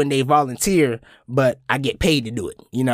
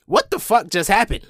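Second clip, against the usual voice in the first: retold bafflement; 6 semitones higher than usual. Retold baffled reaction to compliment.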